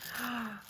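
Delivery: quick high breathy gasp; sharp excited gasp. Real, not acted. Unboxing the nail bag, a sharp delighted gasp.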